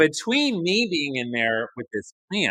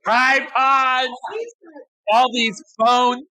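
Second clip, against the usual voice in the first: louder and higher, disbelieving; loud mock disbelief; 10 semitones higher, 6 dB louder, much slower than usual. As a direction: shout out the absurd gear list. Mocking the overpacked gear.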